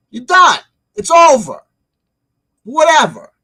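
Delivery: loud, high, emphatic dismissal; dismissive outburst. Real, not acted. Ranter telling off the predictor, dismissive outburst.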